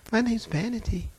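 soft, sweetly demure demon voice; sweet little innocent reply. Retold or imitated. Possessed lady answers pastor, sweet and demure. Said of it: "just as sweet and demure".

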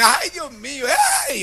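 high-pitched imitated wail; mock crying. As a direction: imitate a tearful emigrant wailing to God. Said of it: "salen llorando y me ... llorando".

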